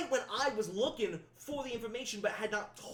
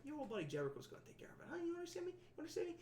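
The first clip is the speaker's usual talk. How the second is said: menacing reassurance; 12 semitones higher, 3 dB quieter, faster than usual. Acted.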